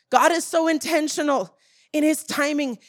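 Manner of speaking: normal talking voice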